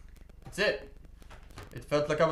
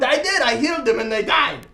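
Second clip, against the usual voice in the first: high, loud, amazed confirmation; excited shouting; 8 semitones higher, 10 dB louder than usual. Acted. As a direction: shout the absurd punchline. Character excitedly confirms absurd outcome.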